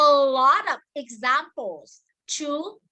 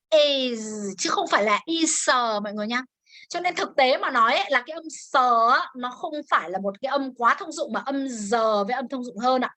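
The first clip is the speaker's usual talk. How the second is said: ordinary delivery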